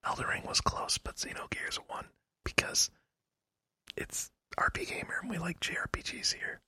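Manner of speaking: hushed secretive whisper. Pretend. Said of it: "why are we whispering?".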